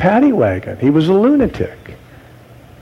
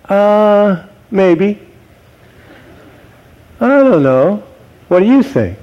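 a noncommittal shrug of a reply, lightly voiced; dither, uh, maybe; mock hesitant answer; 4 semitones higher than usual. Preacher mocking an evasive Jesus, hesitant and unsure. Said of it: "Jesus didn't say".

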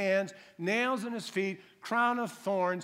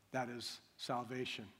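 normal talking voice

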